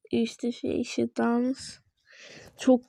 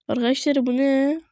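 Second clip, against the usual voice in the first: ordinary delivery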